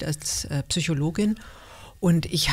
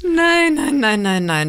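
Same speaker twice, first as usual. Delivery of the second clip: loud, high burst of no's; emphatic denial; 5 semitones higher, 6 dB louder, slower than usual. Real, not acted. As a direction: cut him off with a firm no. Energisches Abstreiten im Interview. (energisches Nein)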